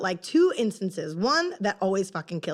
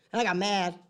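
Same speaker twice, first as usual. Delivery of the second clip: usual speaking voice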